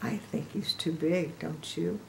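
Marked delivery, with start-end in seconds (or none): none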